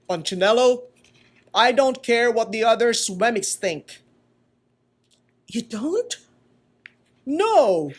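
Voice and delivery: loud, firm, caring woodcarver voice; earnest maker speaking to Punchinello; kind old maker. Acted. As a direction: reassure the little puppet firmly. Eli voice held in story.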